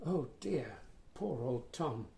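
ordinary delivery, nothing marked

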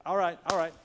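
rally the crowd with a rising shout; calling out. Real, not acted. Rallying congregation to shout together.

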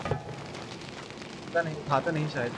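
usual speaking voice

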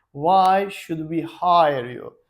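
slow, deliberate model question; slow interview question. Shown as a demonstration. Teacher models the interviewer's question, slowly. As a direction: ask it slowly so learners can copy. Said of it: "En çok sorular".

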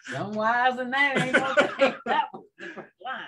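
talking through real laughter; laughing. Real, not acted. Host teasing referee about wife joke, laughing hard. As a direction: crack up while praising the joke.

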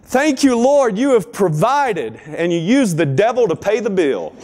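high, delighted cry of thanks; exclaiming. Retold or imitated. Single mom answering the neighbor's taunt, delighted. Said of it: "the lady exclaimed".

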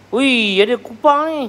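high, loud exclamation of discovery; pleasant surprise. Real, not acted. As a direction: exclaim at an unexpected find. Spots mussels among the dishes.

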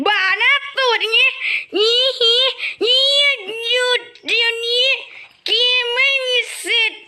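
high cartoon squeak, whole line up high; chipmunk-high mocking voice; squeaky character voice. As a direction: play a tiny squeaky creature. Squeaky voice taunting other players.

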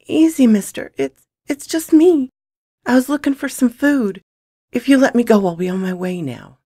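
put-on high-pitched little boy voice, nervous; small stammering boy. Boy caught stealing food pleads with gunman, stammering. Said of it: "a small voice stammered".

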